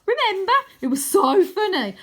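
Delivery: high laughing excitement; gleeful. Acted. Wyn reminding Arthur, high gleeful shout. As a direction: squeal it with delight.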